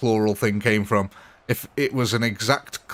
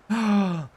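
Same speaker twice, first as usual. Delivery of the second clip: sharp gasp; 9 semitones higher than usual. Real, not acted. Reacting to chat on stream.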